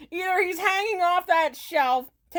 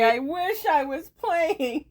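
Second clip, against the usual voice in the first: ordinary delivery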